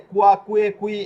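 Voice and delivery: কুয়া, কুয়ে, কুই with a u glide; model qu pronunciation. Shown as a demonstration. Teacher reciting the qu syllables for learners, কুয়া, কুয়ে, কুই emphatic. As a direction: list কুয়া, কুয়ে, কুই loudly, u joined to each vowel. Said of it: "এরকম উচ্চারণ করে".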